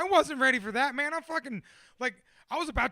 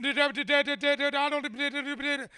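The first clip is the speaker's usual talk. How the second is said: mock angry babble, higher and louder; angry gibberish; 4 semitones higher, 3 dB louder than usual. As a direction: spit out angry nonsense syllables at the question. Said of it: "visibly angry".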